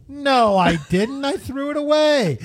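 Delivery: husband defending throwing the seat cover away; high indignant. Retold or imitated. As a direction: rise in disbelief at the question.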